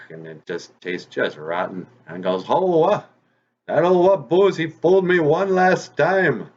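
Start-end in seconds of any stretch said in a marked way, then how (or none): 2.5-6.5 loud, high wail of fury; angry fox. A narrator's reading. Fox with a mouthful of rotten bark, furious. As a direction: cry out high and loud, drawing out the last words. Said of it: "And he's so angry".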